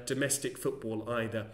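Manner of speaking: ordinary delivery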